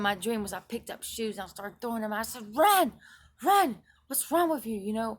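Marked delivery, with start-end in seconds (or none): 2.6-4.8 high, very loud exasperated shout; angry high shriek of run; furious yelling. Retold or imitated. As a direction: scream at him while hurling shoes. Throwing shoes, yelling at him.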